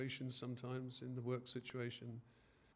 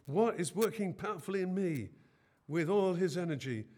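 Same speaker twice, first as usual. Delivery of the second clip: preaching; 4 semitones higher, 7 dB louder than usual. Acted. Preacher voicing Paul, louder.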